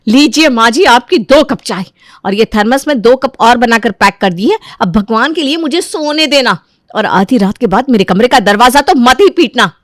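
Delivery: mildly angry, exasperated daughter-in-law; irritated grumbling. Acted. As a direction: hand it over with pointed annoyance. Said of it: "गुस्से में बड़बड़ाते हुए".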